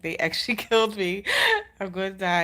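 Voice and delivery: wry laughing disbelief. Retold or imitated. She laughs as she recalls her fear of dying. Retold panicked thought, laughing.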